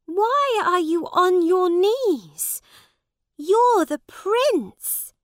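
put-on a worried girl pleads with him; Lady Jane crying out. A narrator's reading. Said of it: "she cried".

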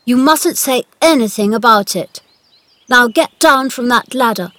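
loud, imperious instructions; commanding. A narrator's reading. Boy master giving the gardener orders, commanding. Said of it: "I'm your master".

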